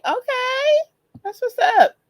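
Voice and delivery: high-pitched excited squeal; high, happy burst of approval; delighted approval. Real, not acted. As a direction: cheer it high and pleased. Delighted viewer is on YouTube.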